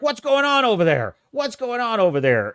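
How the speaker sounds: suspicious stranger calling over; calling across. Retold or imitated. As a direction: shout to the far side.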